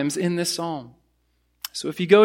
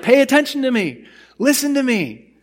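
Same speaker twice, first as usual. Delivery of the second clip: demanding attention; 4 semitones higher than usual. Retold or imitated. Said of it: "You're making a loud noise".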